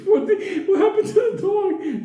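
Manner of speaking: very high, laughing exclamation; hammed-up shocked orderly; mock alarm. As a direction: squeal the question through laughter. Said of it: "He's like".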